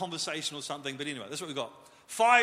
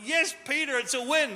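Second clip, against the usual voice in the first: excited cheer; 7 semitones higher, 4 dB louder than usual. Pretend. Cheering Peter walking on water.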